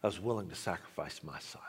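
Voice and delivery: hushed whisper of God; God whispering his sacrifice to Nard. Acted. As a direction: breathe it out as a secret of love. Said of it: "God spoke to him and said".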